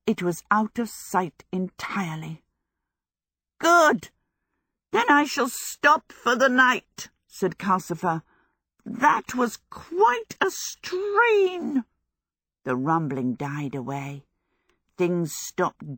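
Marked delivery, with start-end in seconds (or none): 3.6-7.1 high, forceful character voice, drawn out; high-pitched fire demon calling a halt. A narrator's reading. Fire demon announces he will stop for the night, high character voice. 8.8-11.8 very high, long-drawn character voice; strained fire demon. A narrator's reading. Fire demon complains after moving the castle, high strained voice.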